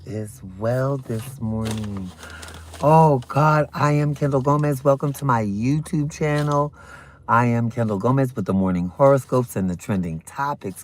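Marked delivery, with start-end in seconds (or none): none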